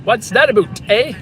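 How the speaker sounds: put-on Canadian, with a perky "eh"; mock Canadian accent. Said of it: "switched from being French to Canadian".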